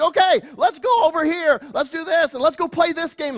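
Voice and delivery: bright, high, upbeat leader voice; rally the kids with forced cheer; cheery youth leader. Retold or imitated. Youth pastor coaxing the group into a game, high and upbeat. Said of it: "and you're like".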